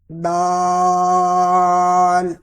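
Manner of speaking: دال stretched very long and slow; long daal. Shown as a demonstration. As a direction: hold the vowel of دال. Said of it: "لمبا کریں گے اس کو".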